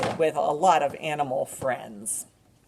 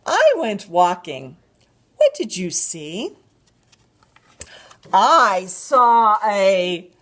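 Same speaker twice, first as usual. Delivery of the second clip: slow, animated picture-book reading; drawn-out storytime voice with gasps; reading to a toddler; 8 dB louder, slower than usual. Acted.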